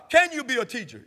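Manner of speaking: usual speaking voice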